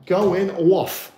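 slow, drawn-out New York accent; hammed-up New York "going off". Shown as a demonstration. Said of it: "to say in my New York accent".

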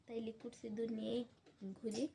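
usual speaking voice

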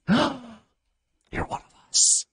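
gasping delight. Pretend. Delighted at Arstotzkan entrant.

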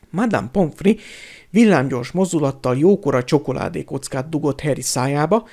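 ordinary delivery

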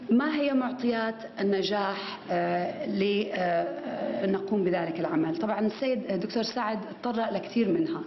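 ordinary talk

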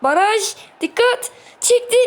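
high, tense match shouts; urgent calls. Real, not acted. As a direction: snap out alarmed warnings at the screen. Dangerous attack, urgent calls.

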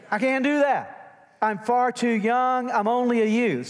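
high, loud mock protest; protesting young Jeremiah. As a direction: play a reluctant prophet crying out his excuses. Voices Jeremiah's protest to God.

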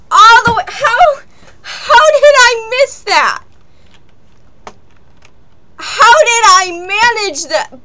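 frustrated yelling. Real, not acted. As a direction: yell at yourself in disbelief. Yelling at own mistake.

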